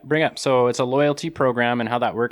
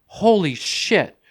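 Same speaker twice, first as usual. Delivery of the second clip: shocked exclamation; 8 semitones higher, 4 dB louder, much slower than usual. Retold or imitated. Sees huge coyote pack, alarmed.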